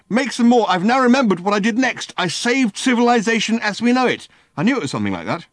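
high, loud, wound-up rant; ranting Ford. A narrator's reading.

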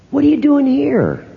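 high, startled question from a passerby; surprised stranger. Retold or imitated. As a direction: act like you can't believe who you just spotted. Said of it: "somebody walked up to me and said".

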